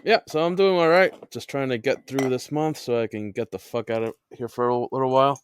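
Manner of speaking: ordinary talk, nothing marked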